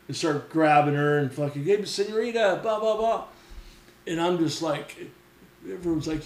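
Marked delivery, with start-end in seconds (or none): 1.6-3.2 higher-pitched imitation of excited men; mock Mexican chatter. Mexican dudes crowding rescued girl, mock chatter. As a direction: lightly mimic men hailing a girl.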